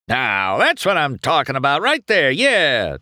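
lightly voiced smug crowing; a touch of a gloating racer. A narrator's reading. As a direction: boast to the silent crowd. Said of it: "Chick crowed".

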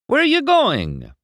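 high, loud, drawn-out question; calling after. A narrator's reading. Racer calls after a departing crewman, calling out. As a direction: call out in disbelief.

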